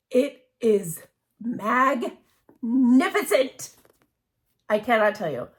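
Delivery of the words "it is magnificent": slow, drawn-out and reverent; awed. Real, not acted. Admiring the Charlie Harper fabric, slow and awestruck. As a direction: stretch the word out in wonder.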